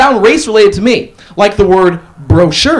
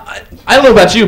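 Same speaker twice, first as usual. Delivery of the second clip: ordinary talk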